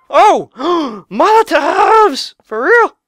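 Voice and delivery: high, stretched-out wonder; excited gasp. Real, not acted. Gamer spotting Molotovs in the shop, thrilled. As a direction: gasp and drag it out, amazed.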